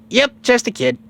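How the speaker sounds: put-on high-pitched kid reply; Goku. Goku agreeing he is just a kid, high-voiced. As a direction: answer in a high little-kid voice. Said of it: "He says".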